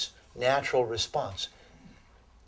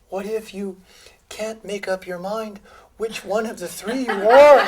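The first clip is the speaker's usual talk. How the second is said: higher, louder bewildered questioner; high, loud mock-confused question; 9 semitones higher, 10 dB louder than usual. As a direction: play the puzzled audience member asking. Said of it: "the person who says".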